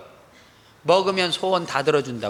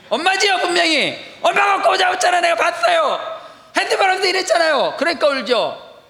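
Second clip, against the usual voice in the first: higher, loud accusing shout; indignant outburst; 13 semitones higher, 5 dB louder than usual. Retold or imitated. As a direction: call her out in front of everyone. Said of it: "내가 이랬어요". (지하철에서 엄마를 꾸짖는 높고 큰 외침)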